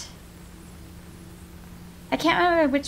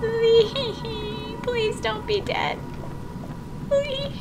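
high, small pleading voice; pleading whimper; 8 semitones higher, 7 dB quieter, slower than usual. Real, not acted. Player begging Lee not to be dead, high pleading whimper. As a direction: whimper the plea, pitched well above usual. Calling out for missing character.

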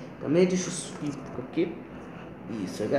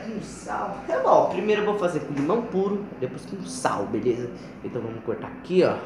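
ordinary talk, nothing marked

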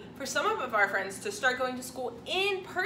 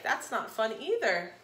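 ordinary delivery

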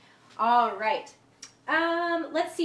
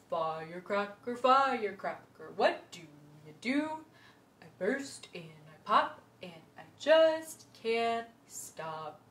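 low voice; 3 semitones lower, slower than usual. Shown as a demonstration. Teacher to kids, chanting the rhyme in a low voice. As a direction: sink your voice down low. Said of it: "let's use a low voice".

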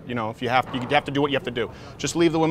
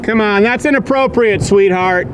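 louder, slow and condescending; 4 semitones higher, 6 dB louder, slower than usual. Real, not acted. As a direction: talk down to her.

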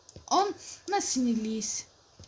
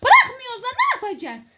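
taunting shout; 10 semitones higher, 7 dB louder than usual. Pretend. Taunting in acted quarrel.

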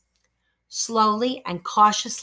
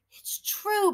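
incredulous thought; 9 semitones higher, 7 dB quieter than usual. Acted. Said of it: "Brogden thought incredulously".

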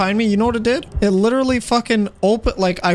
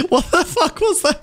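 laughing hard; 2 semitones higher than usual. Real, not acted. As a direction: ask it while cracking up. Cracking up at the game.

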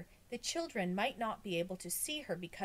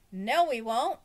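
loud, raised-pitch retort; insistent contradiction; 6 semitones higher, 10 dB louder than usual. A narrator's reading. Boy argues with classmates on the roof, loud retort. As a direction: argue back loudly. Children argue over the coming disaster.

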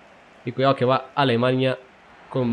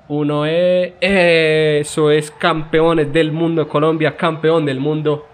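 drawn-out, louder and higher championship shout; long, ringing champions call; triumphant yell; 3 semitones higher, 4 dB louder, slower than usual. Acted. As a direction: bellow the title win to the stadium. Calls out the world title.

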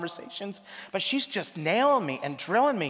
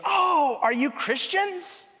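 bright mock surprise; 10 semitones higher, much slower than usual. Mimicking a sweet missionary sister.

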